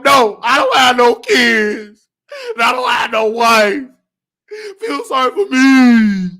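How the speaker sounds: slow, loud, tearful whine; mock wailing. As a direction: drag out each complaint in a hammed-up cry. Wails about having no family.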